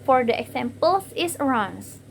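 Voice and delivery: ordinary delivery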